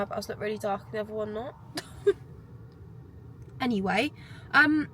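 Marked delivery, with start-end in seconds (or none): 1.6-3.5 breathy high giggle at herself; amused giggle. Real, not acted. Laughing at her own uneven eyebrows, airy giggle.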